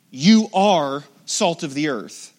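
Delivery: loud, slow stress on You; You boomed out, slow and loud; emphatic. Shown as a demonstration. Preacher reading Jesus' words to the church, emphatic and loud. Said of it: "Did you notice how emphatic it is".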